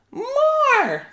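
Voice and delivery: put-on very high babyish demand. A narrator's reading. Baby Finn demanding bread.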